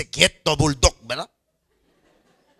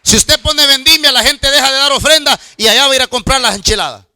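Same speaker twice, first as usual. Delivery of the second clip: louder, driving sermon voice; preaching at full force; 3 semitones higher, 10 dB louder than usual. Real, not acted. Pastor urging congregation to tithe, preaching loud. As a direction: thunder it from the pulpit. Preaching against church sales.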